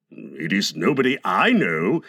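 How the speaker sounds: low, considering murmur; careful scrutiny. A narrator's reading. Father examines the shadow, carefully considering. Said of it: "examining it carefully".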